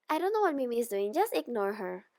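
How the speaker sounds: ordinary delivery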